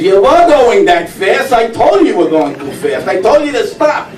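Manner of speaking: high, insistent told-you-so nagging; scolding wife. Acted. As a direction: nag him like a wife who was right. Wife scolding husband in car.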